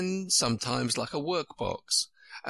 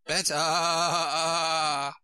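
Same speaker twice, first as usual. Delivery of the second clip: long, high sheep-like bleat; Queen's voice turning into a sheep's bleat; 6 semitones higher than usual. A narrator's reading. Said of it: "The last word ended in a long bleat".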